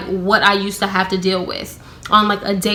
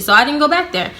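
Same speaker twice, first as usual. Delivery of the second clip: usual speaking voice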